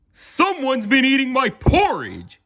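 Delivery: high, put-on Papa Bear voice, a touch alarmed. A narrator's reading. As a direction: play the father bear finding his door open. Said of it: "he exclaimed".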